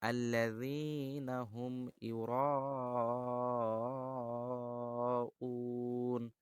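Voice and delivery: very slow chanted recitation; tajweed recitation; Quran recitation. Shown as a demonstration. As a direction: drag the verse out melodically. Recites verses.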